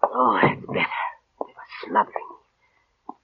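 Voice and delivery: breathless whispered sigh of relief; relieved whisper. Acted. Invalid aunt, freed of the roses, whispers in relief. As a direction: sigh it out in a hushed, spent whisper.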